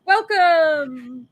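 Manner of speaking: slow, high, halting mock reading; mock confused reading. Cohost reenacting misreading the winner's name, halting mock struggle. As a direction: stumble over the fancy font. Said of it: "I'm like".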